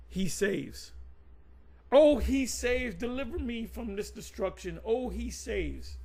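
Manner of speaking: slow, drawn-out mock prayer, lightly voiced. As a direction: pray it out slowly, half in jest. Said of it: "you would have to say".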